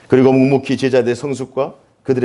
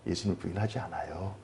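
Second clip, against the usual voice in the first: soft, meek imitation of Peter; mild polite quote; 7 semitones lower, 15 dB quieter than usual. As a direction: say it tamely, like a polite disciple. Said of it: "이렇게 점잖하게 얘기". (점잖게 조용히)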